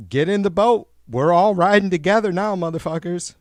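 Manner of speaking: loud and high, hollering at the crowd; shouting the boat analogy at Trump voters; fired-up shout. Real, not acted. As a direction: yell it like you're rallying the troops. Ranting, calling viewers aboard.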